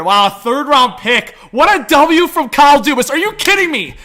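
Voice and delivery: can't believe the great trade; thrilled shout. Real, not acted.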